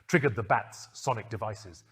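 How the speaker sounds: ordinary delivery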